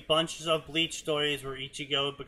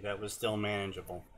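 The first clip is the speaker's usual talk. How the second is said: lower, quiet, quick deadpan repeat; deadpan agreement; 6 semitones lower, 8 dB quieter, faster than usual. Real, not acted. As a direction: mutter it back dryly and fast. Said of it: "Yes, indeed".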